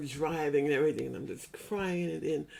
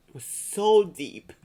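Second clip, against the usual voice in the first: much louder, slow, pressed out with feeling; emphatic and loud; 4 semitones higher, 12 dB louder, slower than usual. Real, not acted. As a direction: let the repeat burst out, slowly. Repeating with rising emotion.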